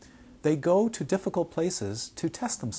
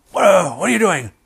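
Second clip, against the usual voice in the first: loud, high mock roar; imitated angry yelling; 5 semitones higher, 13 dB louder than usual. Rinpoche catching the student at the window, imitated yelling. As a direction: yell it like an outraged teacher. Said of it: "He starts yelling at me".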